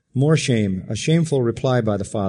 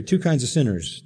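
ordinary delivery

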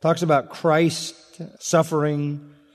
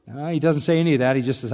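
usual speaking voice

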